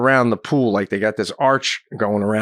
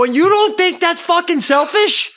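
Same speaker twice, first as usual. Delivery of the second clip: high, loud accusing question; outraged yell; 5 dB louder than usual. Retold or imitated. Acted shouting match over towels.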